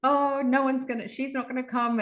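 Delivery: high, deflated no-chance voice; mock doubtful. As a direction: play the doubter giving up.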